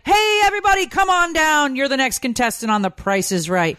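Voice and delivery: high, hammed-up game-show announcer call, easing down at the end; mock a game-show announcer's excited holler; super animated impression. Wife mimicking husband's intro tone, super animated. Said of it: "gets, like, super animated".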